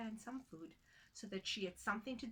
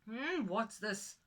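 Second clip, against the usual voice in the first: slow, louder puzzled question; surprised husband; 7 dB louder, much slower than usual. Acted. Husband seeing the meat appear, slow and surprised. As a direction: peer at it, baffled. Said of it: "he asked, surprised".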